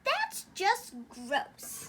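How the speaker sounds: slow, drawn-out disgust. Real, not acted. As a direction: stretch it out, grossed out. Sister disgusted by giant description.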